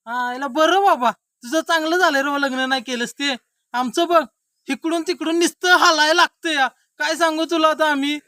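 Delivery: high, loud mock lament; loud complaining. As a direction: complain at full voice about married life. Married man complains to bachelor friend. (चढ्या आवाजात तक्रार)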